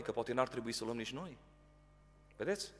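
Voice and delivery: ordinary talk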